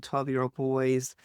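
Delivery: ordinary delivery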